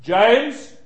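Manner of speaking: teacher calling a pupil's name, high and loud; sharp call. Retold or imitated. Teacher catching a pupil passing notes, loud call. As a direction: snap the name out to catch him. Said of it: "you say".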